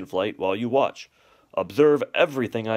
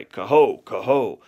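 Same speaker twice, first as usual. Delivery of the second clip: a louder calling swan cry; 7 dB louder, slower than usual. Retold or imitated. Father showing the cygnets the swans' flying cry. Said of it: "as we cry".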